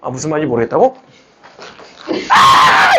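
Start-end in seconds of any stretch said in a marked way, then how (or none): 2.3-3.0 sudden loud, very high cry; high-pitched shouted "ah". Acted. Teacher to a confused student, sudden high exclamation. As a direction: burst out with a piercing "ah".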